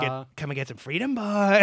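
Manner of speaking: N=Nelson Mandela/public; Mandela impression.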